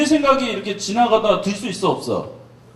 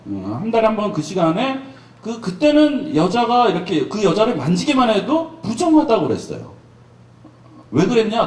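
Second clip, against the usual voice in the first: ordinary talk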